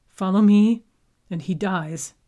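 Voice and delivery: loud snarled threat at guards; snarling. Acted. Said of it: "Haru snarled at the guards".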